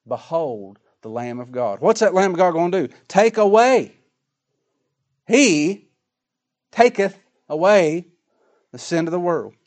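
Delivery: fervent preacher building to a shout; preaching at full force. Acted. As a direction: lift it to a fervent climax. Preaching climax.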